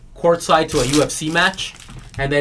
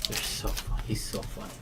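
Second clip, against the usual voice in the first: whispered aside; whispering that he's so funny; 5 semitones lower, 14 dB quieter than usual. Real, not acted. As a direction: breathe it with no voice.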